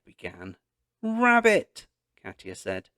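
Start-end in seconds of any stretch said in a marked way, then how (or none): 1.0-1.9 high, loud urgent "Rabbit"; urgent warning. A narrator's reading. Girl warning dad about the loose rabbit, high and loud. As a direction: blurt the warning mid-chase. Katya warns Dad mid-chase.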